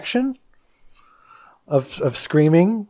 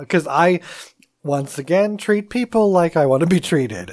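usual speaking voice

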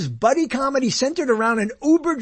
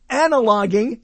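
ordinary talk, nothing marked

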